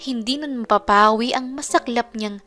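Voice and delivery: usual speaking voice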